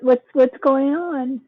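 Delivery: ordinary talk, nothing marked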